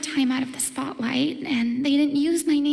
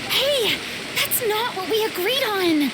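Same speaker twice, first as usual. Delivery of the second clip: surprised protest; 7 semitones higher than usual. Real, not acted. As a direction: call out as if caught off guard by a betrayal. Protesting announcer's surprise credit.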